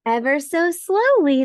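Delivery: usual speaking voice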